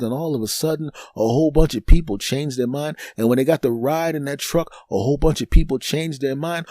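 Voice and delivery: normal talking voice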